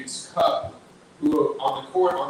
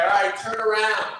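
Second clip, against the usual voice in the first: called out; 9 semitones higher, 6 dB louder than usual. Retold or imitated. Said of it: "and I was like".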